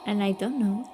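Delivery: ordinary talk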